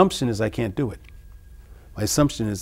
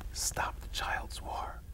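slow, breathy whisper; 17 dB quieter, much slower than usual. Retold or imitated. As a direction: slowly whisper it close to his ear. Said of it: "So I'm gonna whisper to the warrior".

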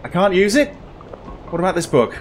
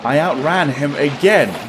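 usual speaking voice

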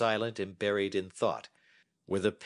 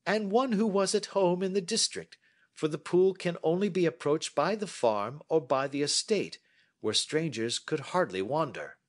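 a touch higher, measured and assured; lightly voiced Holmes; 10 semitones higher, 4 dB louder than usual. Holmes inviting Watson to sit and listen, lightly voiced. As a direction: hold forth like a confident detective.

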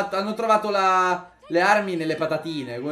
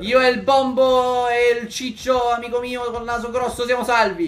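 jubilant calling out; 6 semitones higher, 6 dB louder than usual. Real, not acted. Player announces the little gang is safe, jubilant calling out. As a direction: proclaim the rescue at the top of your range. Celebrating that the characters are safe.

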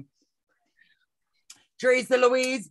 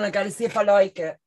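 eating chocolate. Real, not acted. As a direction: mouth full of chocolate. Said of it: "I have chocolate in my mouth".

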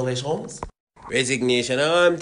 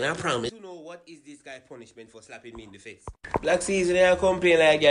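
ordinary talk, nothing marked

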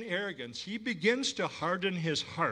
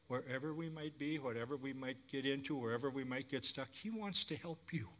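much softer, gentle voice; a tender, quiet promise to the listeners; soft reassurance; 8 dB quieter than usual. Real, not acted. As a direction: ease them down with comfort. Tender assurance after the shout.

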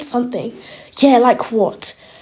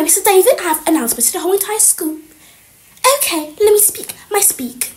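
ordinary talk, nothing marked

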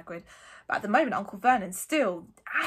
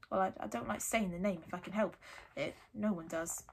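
subdued, stammering reluctance; Hagrid, uneasy; 2 semitones lower, 8 dB quieter than usual. A narrator's reading. Hagrid avoiding the feared name, uneasy. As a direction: hedge quietly.

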